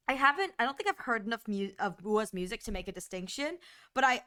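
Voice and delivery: ordinary talk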